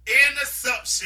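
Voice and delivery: loud, drawn-out call; called-out shout. Retold or imitated. Calling out during game.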